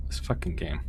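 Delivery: low hushed curse; whispered frustration. Real, not acted. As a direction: mutter it in a defeated whisper.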